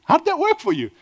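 incredulous challenge to the congregation; pointed question. Shown as a demonstration. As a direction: ask it again, up high and pointed.

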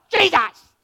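high, loud squawked name; jarring bird-like cry; raucous raven squawk. Shown as a demonstration. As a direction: shriek it like a raven. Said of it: "a raucous squawk sounding like ravens".

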